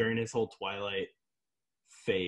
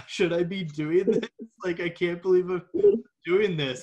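a higher, louder fretting voice; mock anguished actor; 5 semitones higher, 5 dB louder than usual. Imitating the upset actor to the film crew. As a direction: fret aloud as the guilty actor. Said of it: "He was like".

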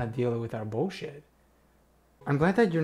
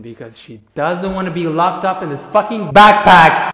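much louder frustrated rant; exasperated outburst; 2 semitones higher, 13 dB louder than usual. Real, not acted. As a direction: let it burst out in frustration.

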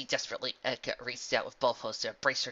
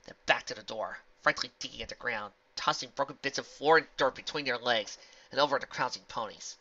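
ordinary delivery

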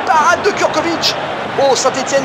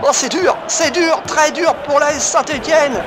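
higher, shouted goal call; excited sports commentary; 5 semitones higher than usual. Real, not acted. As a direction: erupt as the ball hits the net. Goal scored, commentator erupts.

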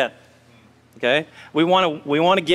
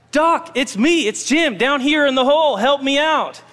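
higher-pitched acted call from below; calling for help; 7 semitones higher than usual. Jim shouts up to his doctor from the hole, mock calling for help. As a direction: call up to someone passing overhead.

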